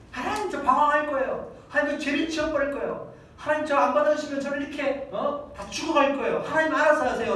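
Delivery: high, petulant threatening whine; whiny sulking child. Retold or imitated. Believer throwing a tantrum at God, high and whiny. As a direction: sulk and bargain with God like a spoilt child.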